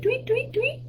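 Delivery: high, soft birdsong tweets; bird tweet. Pretend. Imitating bird for guessing game.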